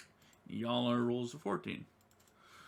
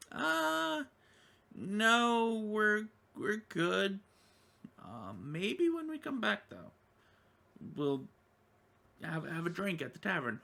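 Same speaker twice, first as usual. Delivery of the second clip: high character voice; 10 semitones higher, 4 dB louder than usual. Acted. Adventurer politely declines the NPC, voice pitched up. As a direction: turn down the offer cheerily, pitched up.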